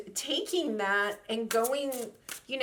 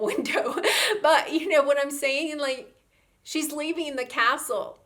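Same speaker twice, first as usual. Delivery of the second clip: usual speaking voice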